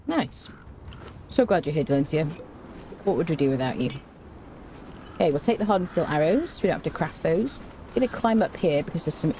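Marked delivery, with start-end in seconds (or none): none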